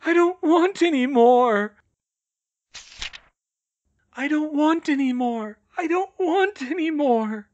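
high, loud pleading wail; frightened. A narrator's reading. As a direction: wail it over and over in terror. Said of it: "He sounded frightened".

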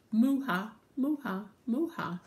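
short mooing ha-has, lightly acted; laughing cows. Other cows waking with a laugh, short moo-has. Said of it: "woke up with a laugh".

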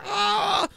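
high crying wail like the man on the train; imitated wailing cry. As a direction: wail it like a madman. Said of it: "I mean, like".

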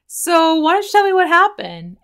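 hammed-up mom questioning her child; imitating a parent. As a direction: mimic a mom gently asking a messy child. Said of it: "you can hear the parent going like".

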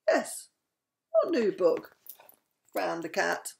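lively read-aloud with voiced animals; bedtime story reading. A narrator's reading.

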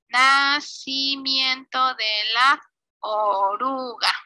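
"nacimiento de la oruga" stretched out slowly; slow dictation. Real, not acted. Teacher writing the caption for pupils, slow dictation. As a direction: draw each word out at typing speed.

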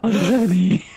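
laughing imitation. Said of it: "It's so robotic, dude".

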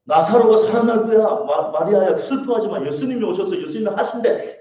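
high, loud, acted rush of good news; joyful announcement. Martha runs to tell her sister the good news, overjoyed. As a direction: burst out with joyful reassurance. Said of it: "기뻐서 마리아한테 뛰어가서".

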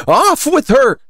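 high, loud queen's command; furious royal roar; thunderous roar. A narrator's reading. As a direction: bellow the order at full voice. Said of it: "began in a voice of thunder".

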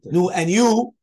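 "you?" swung up high and loud; loud, high questioning "you?"; asked as a question. Shown as a demonstration. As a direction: put it as a lively question, lifting on "and you". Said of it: "like, a question".

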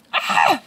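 loud high squeal of delight; excited squeal. Real, not acted. After her gym crush was sweet to her, a loud excited squeal.

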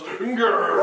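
gruff flexing growl; captain's son. Shown as a demonstration. Leader shows players the captain's son, flexing growl. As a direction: growl it out like a muscleman. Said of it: "the captain's son who flexes and goes".

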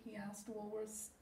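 ordinary delivery

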